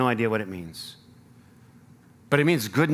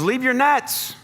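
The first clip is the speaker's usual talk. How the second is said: calling out; 10 semitones higher, 4 dB louder, slower than usual. Acted. Jesus calling the disciples.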